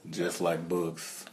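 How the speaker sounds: low, slow, quiet [name] voice; a hint of [name], hushed and slow; [name] impression. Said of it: "That was my best [name]".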